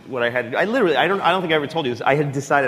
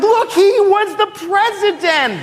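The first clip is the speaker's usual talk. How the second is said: much higher, louder, slow pointing out; excited to the kids; 5 dB louder, slower than usual. Retold or imitated. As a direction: urge your girls to look, thrilled. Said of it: "I'm like".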